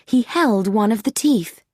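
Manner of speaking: plain reading voice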